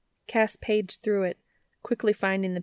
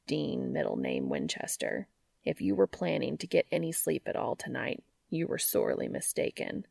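seductive voice; 5 semitones lower, 4 dB quieter than usual. Acted. Lover's low teasing threat.

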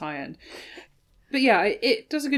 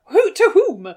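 mock surprise; 11 semitones higher, 10 dB louder, slower than usual. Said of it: "And you're like".

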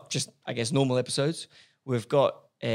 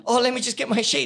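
higher, louder fussy guest voice; mock eager guest; 9 semitones higher, 5 dB louder than usual. Host imitating guest getting her notes, higher and louder. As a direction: play the over-prepared guest fetching notes.

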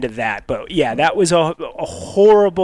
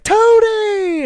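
high excited call of his name; shrill greeting shout; 4 dB louder than usual. Retold or imitated. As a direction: call Tony across the room, delighted. Date spots him, calls his name.

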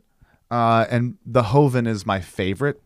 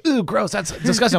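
mock disgusted guest; 11 semitones higher than usual. Guests voiced disliking drink.

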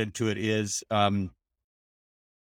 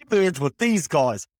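higher, broader mock Australian accent; hammed-up Australian, pitched up; bad Australian accent; 5 semitones higher, 4 dB louder than usual. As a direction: lay on a badly faked Aussie accent. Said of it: "that's a bad Australian accent".

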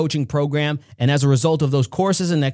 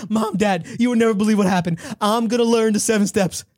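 breathless excitement; 4 semitones higher, faster than usual. Acted. Kim gushing to her parents.

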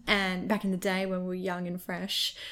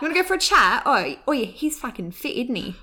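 higher, louder hammy Essex voice; fake Essex accent; 5 semitones higher, 3 dB louder than usual. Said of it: "shocking accents there".

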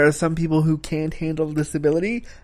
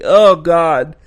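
cringing; 3 semitones higher, 8 dB louder than usual. Real, not acted. Cringing at the scene.

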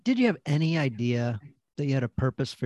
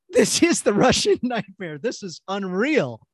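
retold amazement, higher then drawn out; amazed realization; 4 semitones higher, 3 dB louder, slower than usual. Podcast host reliving discovering who his guest was, amazed. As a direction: stretch it out in slow, stunned delight.

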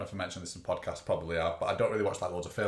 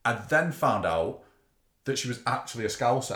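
normal talking voice

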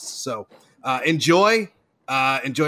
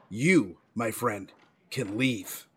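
cold dismissal; 4 semitones lower, 6 dB quieter, much slower than usual. Acted. Director dismisses decorator.

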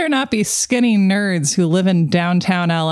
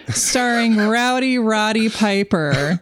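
ordinary delivery

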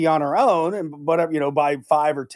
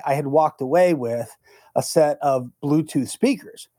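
ordinary talk, nothing marked

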